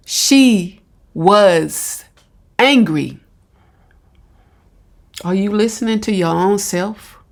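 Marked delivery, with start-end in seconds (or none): 0.1-3.2 very slow, word by word; pointed repetition. Retold or imitated. Commentator repeating the clip's excuse back, slow and pointed. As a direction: quote it back slowly, pausing after each chunk.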